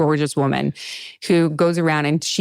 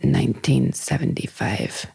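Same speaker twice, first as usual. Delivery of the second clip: breathy whisper, mocking a car-show model; 5 semitones lower, 3 dB quieter than usual. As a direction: whisper the car name like a sultry presenter. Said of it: "the ones where they're like".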